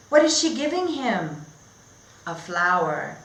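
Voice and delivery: lively, sing-song story reading; reading to children. Acted. Teacher reading a picture book to her class, bright and animated. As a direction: read it like a storybook for little kids.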